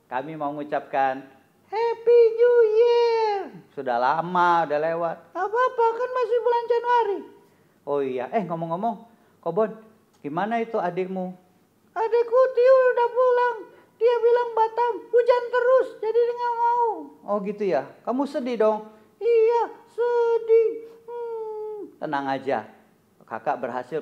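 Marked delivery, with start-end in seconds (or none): 1.7-3.3 put-on squeaky high child puppet voice. Puppet [name] greets the children, squeaky and high. 5.3-7.2 put-on squeaky high child puppet voice. Puppet [name] greets the children, squeaky and high. 11.9-16.9 put-on squeaky high child puppet voice. Puppet [name] greets the children, squeaky and high. 19.2-21.8 squeaky puppet voice, whimpering slowly; a hammed-up little sulk; mock sad puppet. Puppet [name] tells the host he misses his sibling, mock sad.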